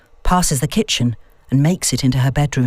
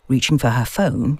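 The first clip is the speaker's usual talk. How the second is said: ordinary delivery, nothing marked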